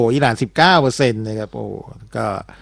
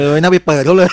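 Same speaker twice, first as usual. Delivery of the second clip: laughing; 5 semitones higher than usual. Real, not acted. Joking about the strait.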